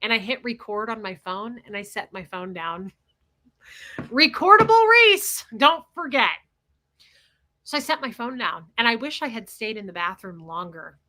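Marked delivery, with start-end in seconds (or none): 4.0-6.4 high, loud, slow brag of a nickname; sing-song louder and higher boast; playful boast. Real, not acted. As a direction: announce the nickname proudly. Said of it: "self-nickname aside".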